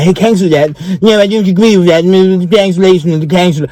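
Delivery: high, put-on voice mocking the cancel crowd; high mock outraged commenter; mock cancel-culture crowd. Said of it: "them people like".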